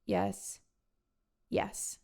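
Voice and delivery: soft, meek yes; a beaten man softly confessing on tape. Retold or imitated. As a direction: give in softly, barely there. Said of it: "Kenneth meekly says".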